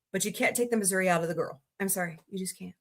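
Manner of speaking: ordinary delivery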